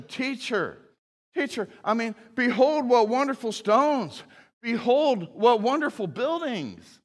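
high, eager and impressed; awed disciple. Retold or imitated. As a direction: gush over the stones. Quotes a disciple admiring the temple.

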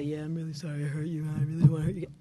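fast, offhand, careless sorry; mock insincere apology. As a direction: rattle off a sorry you don't mean. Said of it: "has to be said sincerely".

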